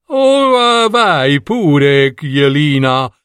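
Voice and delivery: high, slow, gentle fox mother. A narrator's reading. Mother fox sending Jelina off, high and slow. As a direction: send her off like a kindly mother fox. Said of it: "disse mamma volpe".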